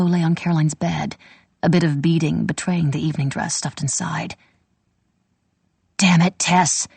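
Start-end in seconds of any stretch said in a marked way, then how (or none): 6.0-6.9 sharp angry hiss at her sister. A narrator's reading. Caught stealing, she hisses at her sister. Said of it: "hissed Ginny".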